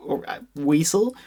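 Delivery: normal talking voice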